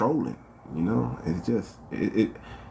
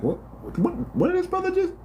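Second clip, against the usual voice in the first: high squeaky what-did-he-just-say; incredulous high-pitched double take; shocked disbelief. Retold or imitated. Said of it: "I'm like".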